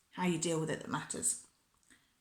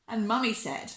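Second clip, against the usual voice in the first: plain reading voice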